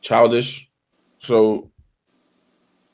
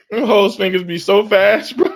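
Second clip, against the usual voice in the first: laughing; 7 semitones higher, 3 dB louder than usual. Real, not acted. Laughing at own joke.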